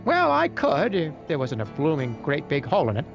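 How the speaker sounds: high, odd goblin voice. Acted. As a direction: play a scheming little goblin. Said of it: "The goblin's voice trails off".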